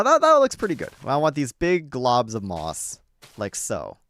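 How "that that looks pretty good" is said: excited planning. Real, not acted. Pleased with moss pattern.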